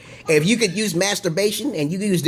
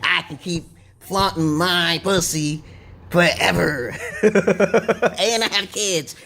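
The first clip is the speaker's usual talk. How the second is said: slow, put-on mocking woman voice; slowly mock a vain woman bragging; much slower than usual.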